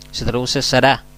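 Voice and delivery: ordinary talk, nothing marked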